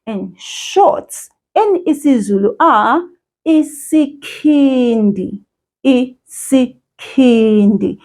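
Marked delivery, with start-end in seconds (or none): 3.5-8.0 isikhindi said slowly, each syllable clear; patient teacher model of isikhindi; slow model word. Shown as a demonstration. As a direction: model isikhindi for the child to repeat. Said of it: "Repeat after me".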